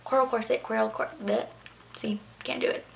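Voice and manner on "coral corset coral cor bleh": rapid-fire attempt that trips up; fast tongue twister. Shown as a demonstration. Vlogger daring viewers to say it three times fast, rushed and fumbled. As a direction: race through the repeats until the tongue tangles. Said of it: "say that three times fast".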